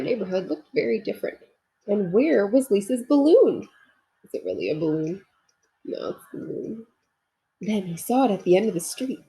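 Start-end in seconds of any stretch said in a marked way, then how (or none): none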